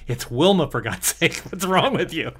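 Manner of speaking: can't believe he's missing what's in front of him; exasperated outburst. Real, not acted. Exasperated rant about the character.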